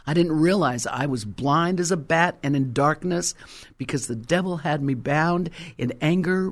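ordinary talk

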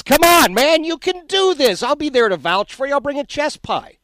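loud, high, eager cheering on; rally him like a hometown friend; 1 loud encouragement. Real, not acted. Host loudly cheering the guest on to come south.

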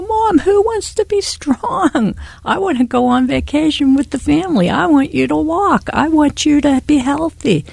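put-on pleading child. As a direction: beg her mother like a little girl. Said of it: "And I'd go".

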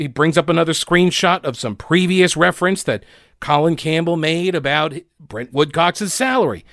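ordinary delivery

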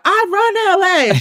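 high, drawn-out mock boast; slow, high-pitched swaggering impression; Natalie Nunn. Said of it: "Natalie's always talking about".

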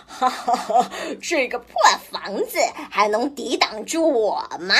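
a wolf's mocking laugh and sneer; wolf laughing at the pigs' wooden house; gloating wolf. A narrator's reading. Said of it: "大灰狼笑着说".

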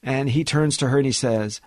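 ordinary delivery

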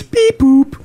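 high two-note electronic beep imitation; scanner beep. Gym-goer imitating the fob scanner rejecting him, high beeps. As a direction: mimic a scanner's error tones, high. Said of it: "and goes".

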